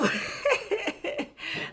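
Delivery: high burst of real laughter; sudden high real laugh. Real, not acted. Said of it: "I'm laughing now".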